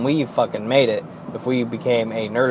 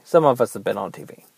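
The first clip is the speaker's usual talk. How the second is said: ordinary talk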